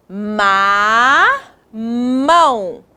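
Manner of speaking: "Ma" held long, pause, then "mão"; syllable by syllable. Shown as a demonstration. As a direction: sound out "Ma, mão" in two drawn-out parts. Teacher joining syllables into word.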